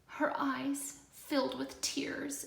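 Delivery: soft, slow whispered narration. A narrator's reading. Reader hushes as the little sister starts crying, whispered. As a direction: hush it, tender and sad.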